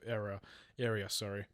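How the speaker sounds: ordinary talk, nothing marked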